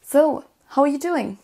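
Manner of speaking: so, how are you doing? asked lightly, up high; relaxed native delivery; casual native question. Shown as a demonstration. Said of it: "On peut ajouter doing".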